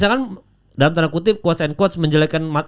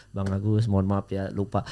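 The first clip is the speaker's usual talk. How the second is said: much lower and softer, a quick sheepish apology; apologetic aside; 9 semitones lower, 10 dB quieter than usual. Real, not acted. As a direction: drop down into a quiet apology. Apologizing aside for forgetting a name.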